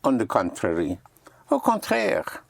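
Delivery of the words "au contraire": "Au contraire" tossed off high and theatrical, like a French know-it-all; mock French flourish. Shown as a demonstration. Teacher showing students the French flourish, mock haughty and high. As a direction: play a Frenchman saying "Au contraire" with a lofty lift. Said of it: "They like to say that".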